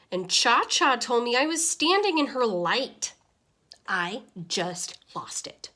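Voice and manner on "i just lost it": ordinary delivery, nothing marked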